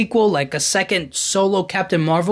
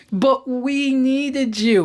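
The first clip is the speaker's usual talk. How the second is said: higher, slow, whiny mock plea; whiny Monica; 6 semitones higher, much slower than usual. Mocking Monica pleading to Captain Marvel, whiny and slow. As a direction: drag it out like a whining kid. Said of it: "she felt so whiny and complaining".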